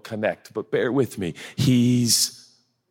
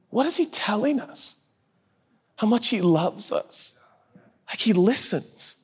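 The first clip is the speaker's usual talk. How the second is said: ordinary delivery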